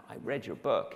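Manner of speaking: lightly imitated American lady caller; American accent. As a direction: put on an American accent for a fan. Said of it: "lady with an American accent".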